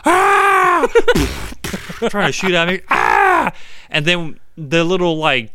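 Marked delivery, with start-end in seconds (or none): 0.1-0.9 high, loud scream of pain; dying scream. Retold or imitated. Dragged outlaw screaming across the plain, high loud shriek. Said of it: "All you heard was". 2.9-3.5 loud mock scream of pain. Dragged outlaw yelling again, loud mock scream. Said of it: "All you heard was".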